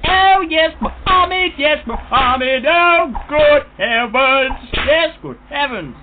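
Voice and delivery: high, loud, slow prissy exclamations; prim gent voice. Pretend. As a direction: gasp it out like a shocked old gent. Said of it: "And he'd be like".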